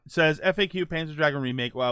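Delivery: usual speaking voice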